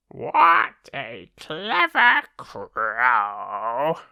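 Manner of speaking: slow, drawn-out crowing self-praise. Acted. Crow congratulating itself at the jug, slow proud croak.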